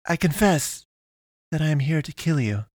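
put-on high, formal girl's voice; confessing alien pastoress. A narrator's reading. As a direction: confess it in a high, stilted character voice. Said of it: "the pastoress said".

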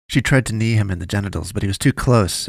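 usual speaking voice

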